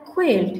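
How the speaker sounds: "কুইল্ট" as khuilt with airy K; aspirated K. Shown as a demonstration. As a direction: release breath on the K of "কুইল্ট". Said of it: "উচ্চারণটা হবে". ("কুইল্ট"-এর শুরুতে মহাপ্রাণ খ)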